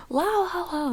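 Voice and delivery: high squeaky singsong la la; SpongeBob singsong. Retold or imitated. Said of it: "I think of SpongeBob".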